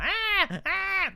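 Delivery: two very high, mock wailing bursts; imitated baby-toy cry. Dad imitating the crying toy he hates, mock wail. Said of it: "toys that make a crying noise".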